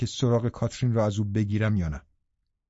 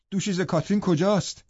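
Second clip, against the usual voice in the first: snapping; 8 semitones higher than usual. A narrator's reading. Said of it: "به او تشر زدم".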